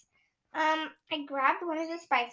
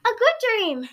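high, loud delighted reply; excited; 9 semitones higher, 6 dB louder than usual. Acted. Iowa cheerfully calling it a good dream. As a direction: say it with glee.

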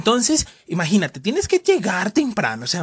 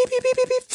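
pitido muy agudo imitado; beeping turnstile. Retold or imitated. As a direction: suena como la máquina. Imita el torniquete. (pitidos agudos del torniquete imitados)

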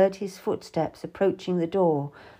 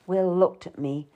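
ordinary delivery, nothing marked

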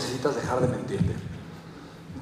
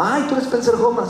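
mock excited welcome; 10 semitones higher than usual. Excited greeting at the airport.

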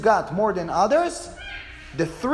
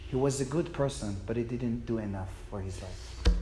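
ordinary talk

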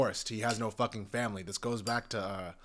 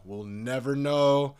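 louder and slower, know stretched out; slow, loud declaration of uncertainty; emphatic repeat; 3 semitones higher, 7 dB louder, slower than usual. Shown as a demonstration. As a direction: drive the point home the second time.

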